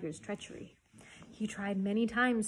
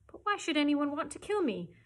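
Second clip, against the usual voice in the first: higher-pitched, bewildered question; storybook boy, voice raised in puzzlement; puzzled protest; 7 semitones higher, 5 dB louder than usual. A narrator's reading. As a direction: ask it in innocent disbelief. Mowgli asks in disbelief, acted.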